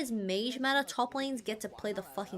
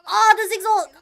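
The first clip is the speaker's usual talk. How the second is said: excited shout; 10 semitones higher, 14 dB louder than usual. Real, not acted. Reacting to a big play.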